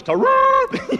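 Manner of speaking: very high, loud mock jungle cry; Tarzan call. Tarzan calls to the pygmies, high loud cry. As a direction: yelp it out like a wild man. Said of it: "looks at all of 'em and goes".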